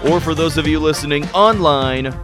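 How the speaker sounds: big, loud show-opening hype; radio announcer. Acted. Radio host opens the show.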